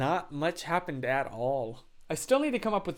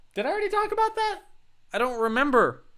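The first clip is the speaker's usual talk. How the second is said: squeaky high voice; 11 semitones higher, 5 dB louder, faster than usual. Real, not acted. Sudden squeaky self-question.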